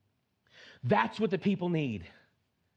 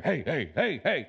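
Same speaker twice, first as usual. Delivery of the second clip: mirror hype; 4 semitones lower than usual. Retold or imitated. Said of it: "this is me in the morning talking to myself in the mirror".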